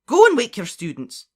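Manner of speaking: high, loud order; sharp command. Acted. McGonagall orders Slughorn away.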